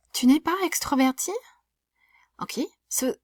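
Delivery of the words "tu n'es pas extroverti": "Tu n'es pas extroverti" pitched high and louder, up at "extroverti"; rising question intonation. Shown as a demonstration. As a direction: ask it with "extroverti" lifted high. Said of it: "make my voice go up at the end".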